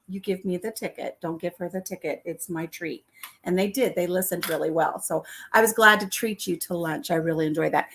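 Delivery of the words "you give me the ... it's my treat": quiet, secretive request; hushed. Retold or imitated. Retold hushed request to staff.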